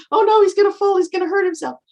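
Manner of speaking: shrill-high, loud mock alarm; mock panicked mom. Mom watching her kid climb a tree, high loud mock panic. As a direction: squeal it like a fretting mother.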